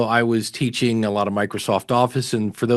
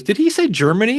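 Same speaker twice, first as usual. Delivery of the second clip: surprised brother; 10 semitones higher, 3 dB louder than usual. Retold or imitated. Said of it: "He goes".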